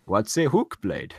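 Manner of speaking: puzzled question in a put-on Italian accent; mock Ezio. Streamer acting out Ezio asking about the blade, mock Italian accent. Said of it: "Ezio's like".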